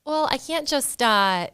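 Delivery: hammed-up Minnesotan vowels; Minnesotan accent. Shown as a demonstration. Host redoing last week's shtick, Minnesotan accent. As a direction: ease into a broad Minnesotan accent. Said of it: "Do yours again".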